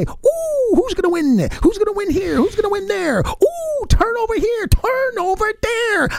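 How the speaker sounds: very high, giddy squeal of wonder; giddy Christmas-morning excitement. Acted. Fan acting out opening presents on game day, giddy high excitement. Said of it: "open up the little package in the morning and say".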